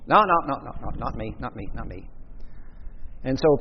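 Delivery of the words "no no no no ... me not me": frantic denial fading to a hushed, lower mutter; a scared man's shrinking no; panicked denial. Retold or imitated. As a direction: back away from the accusation, voice shrinking. Peter frantically denying.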